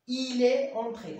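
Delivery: slow, loud and high, entré stretched; teacher stressing a model sentence; emphasized example. Shown as a demonstration. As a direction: hold each word of "Il est entré" out for the class. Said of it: "هذا راه الدري".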